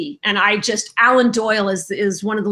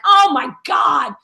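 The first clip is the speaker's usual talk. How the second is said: drawn-out, higher exclamation; mock exasperation; 4 semitones higher, 3 dB louder, slower than usual. Co-host answering her friend's insult, mock exasperated. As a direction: stretch it out in mock horror.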